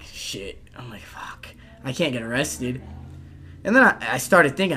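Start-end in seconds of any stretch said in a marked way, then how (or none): 0.0-2.8 hushed, much softer panicked mutter. Retold or imitated. Hiding in the woods, hushed and panicked. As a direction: mutter it under your breath, scared.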